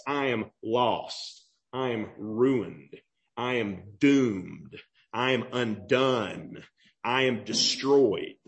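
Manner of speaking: low, slow, each phrase sinking; grave, crushed confession; Isaiah's despair. Acted. Preacher voicing Isaiah's despair.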